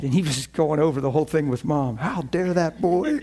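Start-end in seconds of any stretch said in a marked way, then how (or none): 2.0-3.2 slow, outraged dad voice; indignant father. Retold or imitated. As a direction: act out the offended father. Father ranting about son to mom.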